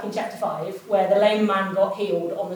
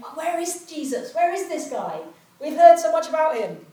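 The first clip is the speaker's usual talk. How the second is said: higher voice of an eager crowd asking after Jesus; lightly voiced curious festival-goer, higher; 6 semitones higher than usual. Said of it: "people ... are going".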